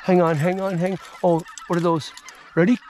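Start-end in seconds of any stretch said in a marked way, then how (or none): none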